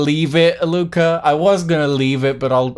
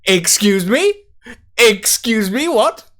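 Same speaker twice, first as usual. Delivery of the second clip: mock surprise; 7 semitones higher than usual. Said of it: "I was like".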